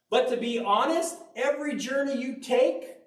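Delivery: normal talking voice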